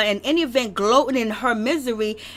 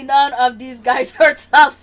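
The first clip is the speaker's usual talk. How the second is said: laughing; 6 dB louder, slower than usual. Real, not acted. Laughs mid-line.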